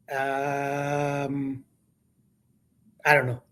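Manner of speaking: retold sheepish, stalling answer; hesitant. Broadcaster stalling when colleague asks a player's number, hesitant.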